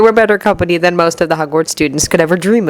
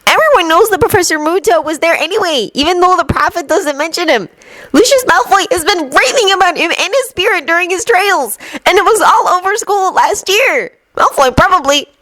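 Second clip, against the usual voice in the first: lightly voiced Luna; 13 semitones higher than usual. A narrator's reading.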